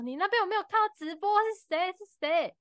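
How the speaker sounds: a high, urgent, mock-anxious asking; retold panic. Fan asking Mary who fell, mock anxious. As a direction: replay the panic of not knowing who fell.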